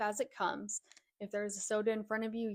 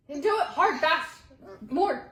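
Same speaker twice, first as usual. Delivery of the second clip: urging; 6 semitones higher, 7 dB louder than usual. Real, not acted. Urging friend loudly.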